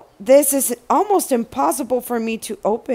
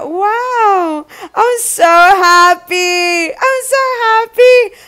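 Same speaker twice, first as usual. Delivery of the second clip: higher and louder, squealing with joy; overjoyed; 8 semitones higher, 4 dB louder than usual. Real, not acted. Thrilled at the shirts, rising joyful squeal. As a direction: repeat it, climbing higher each time.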